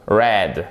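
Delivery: RED with an English R and a marked vowel; exaggerated English RED. Shown as a demonstration. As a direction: model RED low, in careful English. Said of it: "elle est marquée aussi".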